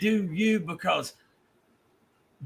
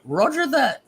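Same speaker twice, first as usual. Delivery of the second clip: higher-pitched, rough attempt at English accent; 8 semitones higher than usual. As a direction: imitate an English woman's catchphrase, accent slightly off. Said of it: "I have to work on my English accent".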